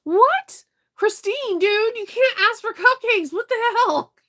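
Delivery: high, loud disbelieving cry; incredulous outburst. Real, not acted. Streamer reacting to a viewer asking for cupcakes, incredulous high outcry. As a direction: throw your voice up in shocked disbelief.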